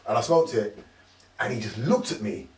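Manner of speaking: usual speaking voice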